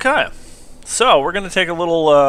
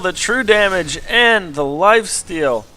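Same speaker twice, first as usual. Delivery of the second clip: higher, louder caster call of the fight; excited play-by-play; 8 semitones higher, 7 dB louder than usual. Acted. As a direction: call the action like a live caster. Live play-by-play of replay.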